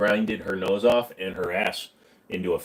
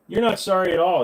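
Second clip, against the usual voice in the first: higher and louder, leaning hard on not; mock hurt accusation; 8 semitones higher, 4 dB louder than usual. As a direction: play it wounded, hitting not.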